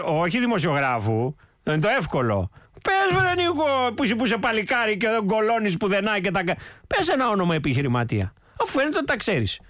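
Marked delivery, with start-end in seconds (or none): 2.8-3.6 goading call, again. Acted. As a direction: press him harder to name names. Host goading the politician.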